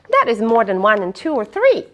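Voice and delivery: plain reading voice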